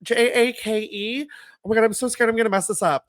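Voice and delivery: retold nervous fluster. Stacy signing his name, imitated high-pitched nervous fluster. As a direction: imitate careful spelling tumbling into flustered worry. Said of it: "she's like".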